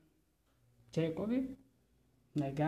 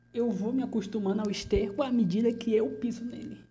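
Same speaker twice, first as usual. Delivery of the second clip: louder, higher bitter declaration; bitter outburst; 5 semitones higher, 7 dB louder than usual. Acted. Prisoner declares his resignation loudly to the delegado. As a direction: throw the line out with force.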